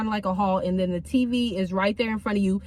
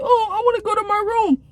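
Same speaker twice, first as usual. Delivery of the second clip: put-on much higher childlike voice; special-needs kid asking staff for his room, high and childlike; imitating the kid; 11 semitones higher than usual. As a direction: play a little kid asking to leave.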